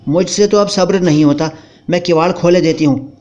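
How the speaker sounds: wife sarcastically mocking her husband; scornful wife. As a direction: taunt the timid husband. Wife's voice again.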